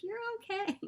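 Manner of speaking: high, kind reassurance; sweet shop lady. Retold or imitated. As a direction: soothe her like a kind clerk. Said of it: "They're like".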